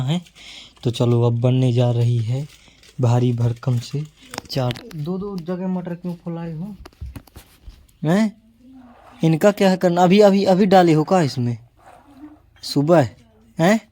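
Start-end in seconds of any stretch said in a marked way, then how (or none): 5.0-13.8 high, loud scolding. Real, not acted. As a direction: tell her off for the peas. Scolding sister over cooking. (बहन को ऊँची आवाज़ में डाँटना)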